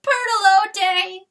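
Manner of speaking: long, high, loud wail of the name. A narrator's reading. Chanticleer wailing at his wife's shadow, high and slow. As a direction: wail for her, drawn out.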